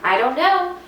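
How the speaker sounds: little bird chirping in wonder; chirped. A narrator's reading. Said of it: "chirped Flitter".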